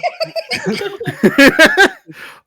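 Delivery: loud, high burst of laughter; a big squealing laugh at the punchline. Real, not acted. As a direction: crack up hard. Cracking up at the story.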